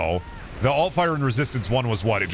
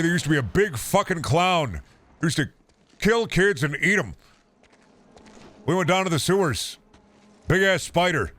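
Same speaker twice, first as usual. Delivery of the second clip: put-on old-timer voice; 6 semitones higher than usual. Old-timer boasting to viewers about childhood spiders. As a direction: ham up a grizzled old-timer telling war stories.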